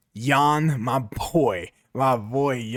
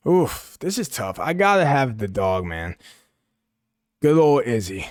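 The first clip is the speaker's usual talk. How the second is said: ordinary talk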